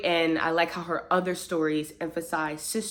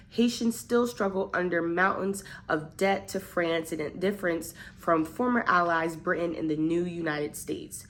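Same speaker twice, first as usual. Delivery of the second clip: normal talking voice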